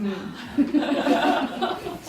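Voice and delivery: high breathy burst of laughter; short laugh. Real, not acted. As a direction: laugh out at the absurd comfort. Laughing at the bad comfort. (터져 나오는 짧은 웃음)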